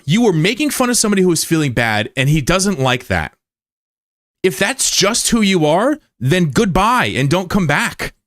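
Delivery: high-pitched heated scolding, slowing on key words; angry confrontation. Real, not acted. Streamer telling off Spooky for needling him, angry and high. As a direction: tear into the chatter who mocked you.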